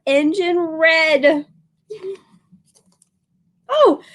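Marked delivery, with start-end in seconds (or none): 3.7-4.0 a high startled sorry; flustered apology. Acted. Shopkeeper flustered, apologizing to the boy, higher. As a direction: cry it out in a fluster. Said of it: "cried the shopkeeper".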